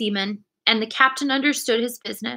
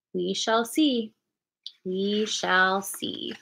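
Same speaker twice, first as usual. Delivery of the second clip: slow and quieter, trailing off; slow, fading musing; 6 dB quieter, much slower than usual. A narrator's reading. Doctor musing to the squire, slow and fading. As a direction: let it fade thoughtfully, slower each time.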